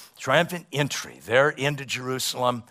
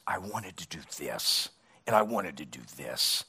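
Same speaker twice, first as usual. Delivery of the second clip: much lower and softer, a grieving, regretful God; the Lord's voice; 8 semitones lower, 7 dB quieter than usual. Retold or imitated. The Lord speaking to a believer at life's end, low and sorrowful. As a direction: speak as God mourning what might have been. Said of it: "have the Lord say to us".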